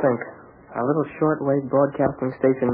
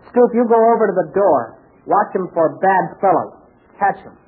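urgent orders; 7 semitones higher than usual. Acted. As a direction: bark out orders quickly.

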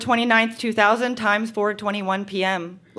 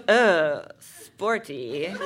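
imitating teenage boyfriend; 8 semitones lower, much slower than usual. Boyfriend answers her about the sports bra, low mock voice. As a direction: drop down low for the unimpressed boy. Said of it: "And then he goes".

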